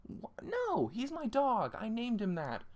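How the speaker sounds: squeaky, desperate claim; frantic chaser. Acted.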